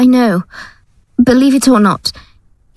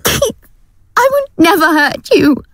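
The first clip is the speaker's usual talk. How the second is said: high, tearful swearing; crying plea; 10 semitones higher, slower than usual. Acted. Gail swears to Keith through tears, crying.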